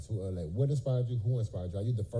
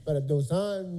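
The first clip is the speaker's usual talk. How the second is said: slow, raised, careful broken Spanish; 4 semitones higher, slower than usual. Retold or imitated. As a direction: sound out shaky beginner Spanish slowly. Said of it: "broken Spanish quote".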